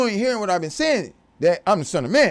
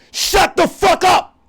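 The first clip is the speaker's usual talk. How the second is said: drawn-out, slow high yell, much louder; furious outburst; 9 semitones higher, 5 dB louder, much slower than usual. Real, not acted. Ranting at listeners who talk over him, slow furious yell. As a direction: slam the words out slowly at full volume.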